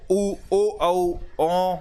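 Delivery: ऊ, ओ, औ with lips rounded; rounded vowel demo. Shown as a demonstration. Teacher showing rounded vowels, exaggerated lip rounding. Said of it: "जैसे उ".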